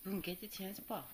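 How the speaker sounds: ordinary delivery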